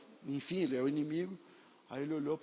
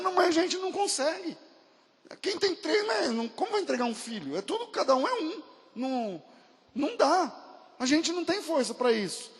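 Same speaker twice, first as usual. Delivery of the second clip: anguished refusal; 10 semitones higher, 3 dB louder than usual. Retold or imitated. Tribesmen refusing, emotional.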